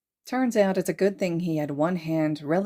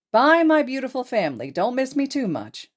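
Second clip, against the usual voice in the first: cheerful loud goodbye; 8 semitones higher, 5 dB louder than usual. Acted.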